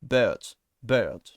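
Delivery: "Bird" with an open French-like vowel; French-accented English; French speaker's bird. Shown as a demonstration. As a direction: say "bird" as a French learner would. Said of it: "I tend to say something which is close to this".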